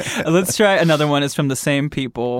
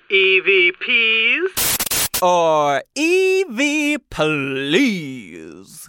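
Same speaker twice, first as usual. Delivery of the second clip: unearthly voice; 5 semitones higher, much slower than usual. Acted. Spooky EVP segment intro over music.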